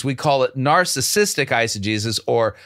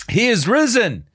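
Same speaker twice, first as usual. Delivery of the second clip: joyful proclamation; 6 semitones higher than usual. Real, not acted. Proclaiming after reading it.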